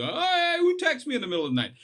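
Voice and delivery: high, fast mock grumble at the phone; grumpy phone check. Waking up in the night to phone texts, a mock grumpy squeak. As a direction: squeak it out like you just woke to a text. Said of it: "The first thing you do is".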